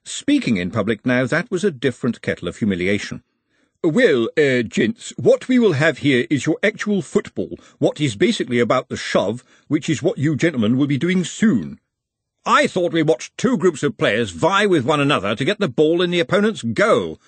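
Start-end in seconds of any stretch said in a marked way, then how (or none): 3.8-11.8 stiff, high-pitched formal address; awkward public speaker Ottomy. Acted. 12.4-17.2 high-pitched, puzzled objection; questioning gentleman. Acted.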